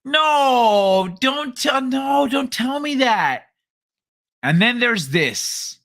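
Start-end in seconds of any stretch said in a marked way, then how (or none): 0.0-3.5 higher pleading no, don't tell me; pleading denial. Retold or imitated. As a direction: beg them not to say it. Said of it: "you go".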